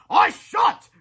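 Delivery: high, loud defiant hero cry. A narrator's reading. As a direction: cry out in heroic refusal. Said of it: "cried Captain Underpants".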